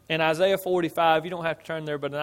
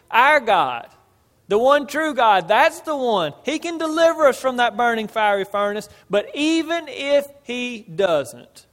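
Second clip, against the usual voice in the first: loud, high, emphatic declaration; 5 semitones higher, 5 dB louder than usual. Retold or imitated. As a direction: proclaim it forcefully in answer to a challenge. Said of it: "and they were emphatic".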